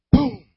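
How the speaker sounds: sudden, very loud "boom" sound effect; explosive boom. Retold or imitated. Preacher mimics authorities kicking in the door, explosive boom. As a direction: burst out with a high, booming bang.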